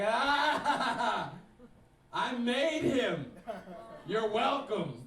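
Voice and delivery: loud, high, drawn-out crowing; hammed-up boastful father yelling; triumphant shout. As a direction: holler it proudly to the whole room, stretching the words. Acts out father's triumphant outburst.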